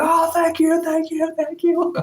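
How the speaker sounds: very high, squeaky gushing thanks; mock grateful crowd. Crowd thanking the crying singer, squeaky high mock gratitude. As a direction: gush it in a tiny high voice. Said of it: "Everyone's like".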